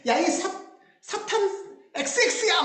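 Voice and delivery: mock scolding. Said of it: "막 이러면서".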